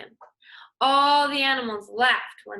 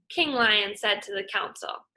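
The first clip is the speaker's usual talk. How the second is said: plain reading voice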